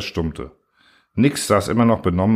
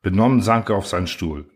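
ordinary delivery, nothing marked

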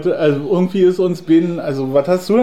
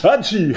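sneezing; 6 semitones higher than usual. Pretend. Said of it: "Wenn du so am Telefonieren, dann musst du niesen".

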